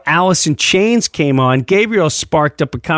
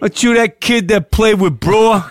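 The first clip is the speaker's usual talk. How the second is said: put-on Italian accent; 7 semitones higher than usual. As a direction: greet him like an excited Italian guitarist. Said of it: "with his heavy Italian accent".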